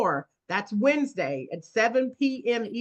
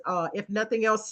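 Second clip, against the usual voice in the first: ordinary talk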